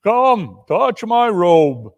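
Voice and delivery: slow, inviting command; lightly voiced giant ghost. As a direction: beckon him gently.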